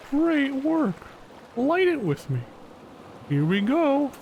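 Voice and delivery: put-on high, cheery children's show host; kids TV host. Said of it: "I feel like I'm at a kid's T V show".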